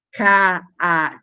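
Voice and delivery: slow "caatinga" as ca, a, tin, ga; syllable by syllable. Shown as a demonstration. Teacher separates one A from the other, "caatinga" syllable by syllable. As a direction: separate each syllable of "caatinga", both a sounds distinct. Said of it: "teve a separação desse A".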